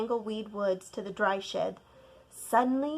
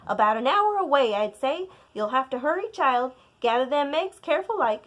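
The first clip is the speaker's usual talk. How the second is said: louder, quick grandmother voice; hurrying grandma; 3 semitones higher, 7 dB louder, faster than usual. Acted. Grandma sending child for eggs, urgent.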